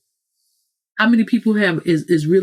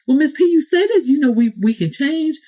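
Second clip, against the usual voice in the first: mock doubting listener; 9 semitones higher than usual. Listener objecting to speaker.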